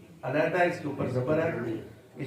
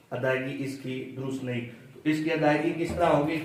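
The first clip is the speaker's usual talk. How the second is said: ordinary talk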